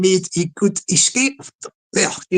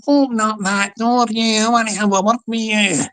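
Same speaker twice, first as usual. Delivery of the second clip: hammed-up doorstep threat, pitched up; gangster at the door; 5 semitones higher than usual. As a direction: play a comic heavy calling through the door. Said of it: "And he's been".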